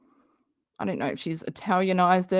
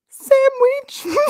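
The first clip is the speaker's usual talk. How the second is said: much higher, loud incredulous sign-off; mocking read; 8 dB louder than usual. Mocking Katie's letter signoff, high incredulous. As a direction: read it out in disbelief.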